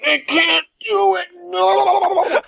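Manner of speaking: high laughing cry of refusal; laughing wail. Retold or imitated. Retelling the kid's refusal, laughing high-pitched. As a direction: squeal it out through laughter, high. Kid acting out Jim Carrey scene.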